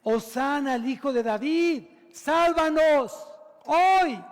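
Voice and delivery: high, drawn-out preacher's shout; crying out loudly. Acted. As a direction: cry for salvation at full voice. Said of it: "gritaban, clamando a gran voz".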